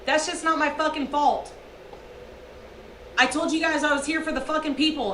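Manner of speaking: normal talking voice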